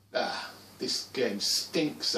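disgusted. Acted. Child's disgusted thought about the game.

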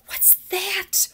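high unvoiced whisper; gasping whisper. Pigeon hearing a bark, startled whisper. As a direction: gasp and whisper it.

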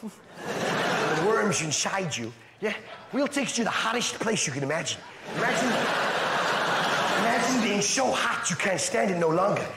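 Dutch stag-do host selling the night; put-on Dutch accent, casual sales patter. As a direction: lay out the package in a Dutch accent. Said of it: "Hell in a Dutch accent sounds like a pretty good stag do".

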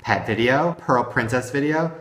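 ordinary talk, nothing marked

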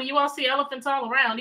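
ordinary talk

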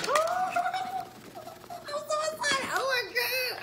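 high, squealing oh my God; excited squeal. Real, not acted. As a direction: squeal it with pure delight. Opening the long-awaited box.

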